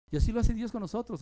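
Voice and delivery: normal talking voice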